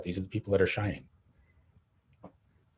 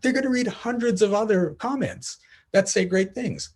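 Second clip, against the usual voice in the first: much higher, pleading to make him see sense; insistent reassurance; 10 semitones higher than usual. Retold or imitated. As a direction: reargue the point with raised pitch. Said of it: "I'm like".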